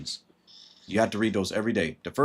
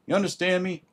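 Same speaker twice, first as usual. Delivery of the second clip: ordinary talk, nothing marked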